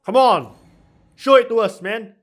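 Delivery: high, loud fan excitement; thrilled shout at the reveal; excited cheering. Real, not acted. Fan reacting to game reveal.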